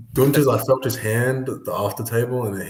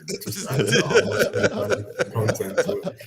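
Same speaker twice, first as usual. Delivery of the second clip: higher pitch through laughter; laughing; 9 semitones higher than usual. Real, not acted. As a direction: laugh as you explain it. Amused explaining his impression.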